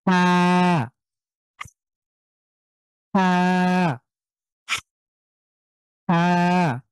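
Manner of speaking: breathy ha pushed from mid-throat; throaty ha demo. Shown as a demonstration. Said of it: "সবাই একটু try করেন তো".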